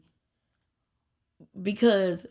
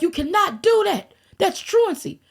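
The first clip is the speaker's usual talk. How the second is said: way higher and louder, hammed-up scolding officer; mock stern cop; 11 semitones higher, 4 dB louder than usual. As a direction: squeak out a scolding like a mocked cop. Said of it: "They were like".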